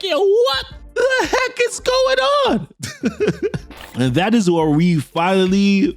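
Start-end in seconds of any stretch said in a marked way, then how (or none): 0.0-2.7 hammed-up falsetto freak-out; flipping out. Harry learns who Mysterio is, comically flipping out. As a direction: squeal it very high and drawn out, in mock panic. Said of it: "So Harry is flipping out".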